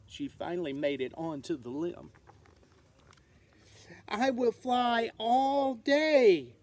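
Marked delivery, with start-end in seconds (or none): 4.1-6.5 louder, slow resolve; determined little bat; determined vow. Acted. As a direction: promise herself firmly. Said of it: "Stellaluna told herself".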